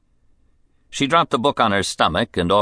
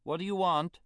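calling from far off, high; distant voice; 12 semitones higher, 13 dB quieter than usual. Acted. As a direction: heard from another room. Calling from the sun deck.

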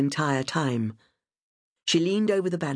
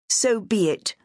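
defiant; 7 semitones higher than usual. A narrator's reading. Charlotte defiant at gunpoint.

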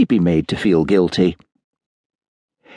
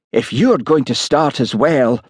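mildly huffy, raised pitch; 6 semitones higher than usual. A narrator's reading. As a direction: take offence, a touch higher. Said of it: "he began huffily".

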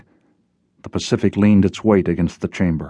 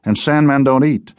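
higher-pitched, fed-up echo; exasperated retort; 5 semitones higher than usual. Acted. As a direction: throw the words back, pitch raised. Fed-up retort echoing her words.

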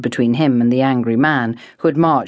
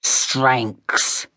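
growled name. A narrator's reading. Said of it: "the man growled".

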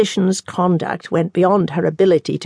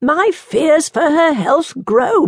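worried physician; 9 semitones higher, slower than usual. Acted. Physician voices worry to monk.

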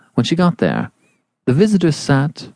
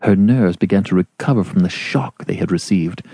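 plain reading voice

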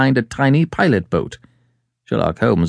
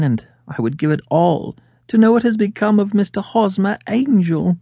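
high-pitched, pleading lady's voice; anxious client appealing for help, pitched up; earnest woman client; 6 semitones higher than usual. Acted. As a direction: plead earnestly in a higher feminine voice. Voices Mary pleading with Holmes.

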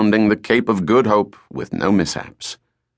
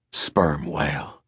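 hushed; 5 semitones lower, 7 dB quieter, slower than usual. Acted. Quiet awed naming.

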